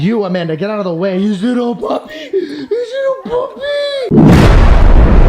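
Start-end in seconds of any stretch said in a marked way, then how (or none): 1.2-5.2 high squealing puppy-cooing voice. Real, not acted. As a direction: coo at a puppy, climbing into a squeal.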